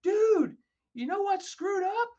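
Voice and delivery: high, loud, slow mock voice; hammed-up teasing buildup; [name]'s teasing setup.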